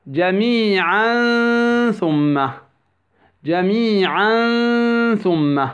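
جميعا ثم with the tanween held as a long hum; ikhfa of tanween. Shown as a demonstration. Tajweed teacher gives an example, long humming ikhfa in جميعا ثم. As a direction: stretch the hidden n at the end of جميعا into ثم. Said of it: "والإخفاء".

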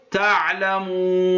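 تعلمون with the waw held four counts; four-count madd. Shown as a demonstration. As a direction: stretch the long u of تعلمون to a medium hold. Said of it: "هذه أربع حركات".

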